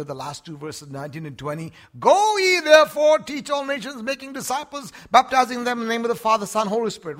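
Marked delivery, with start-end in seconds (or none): 2.0-7.2 high, loud scripture cry; ringing proclamation. Real, not acted. Preacher calling the Great Commission out to the church. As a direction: call the verse out like a herald.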